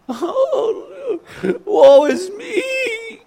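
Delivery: high, slow whiny wail; hammed-up crying over yourself; mock self-pity. Mocking a self-pitying person wailing woe is me.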